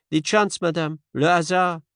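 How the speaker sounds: gentle French-tinged Poirot. Acted. Poirot voiced.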